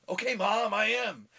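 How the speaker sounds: high, loud whine back at mom; hammed-up protest, pitched high and loud; mock exasperated reply. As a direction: play the nagged son calling back. Mock answer to yelling mom.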